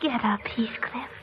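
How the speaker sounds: soft whispered plea. Acted. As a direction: whisper it close to him. Girl tends hurt boy.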